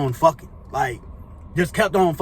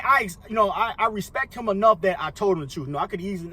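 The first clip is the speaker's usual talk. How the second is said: normal talking voice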